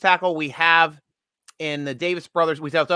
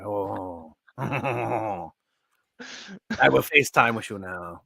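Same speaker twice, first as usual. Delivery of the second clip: seductive; 4 semitones lower, 4 dB quieter than usual. Pretend. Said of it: "Siri likes your accent".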